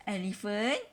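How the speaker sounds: usual speaking voice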